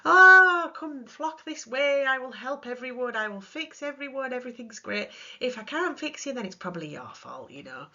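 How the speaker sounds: high, booming then fading grand saviour; mock messiah counsellor; grandiose saviour. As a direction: play the all-powerful rescuer. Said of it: "Or you may feel powerful".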